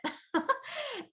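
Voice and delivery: high, light laugh; amused laugh. Real, not acted. Told to move into this apartment, high light laugh. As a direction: laugh happily at the sign.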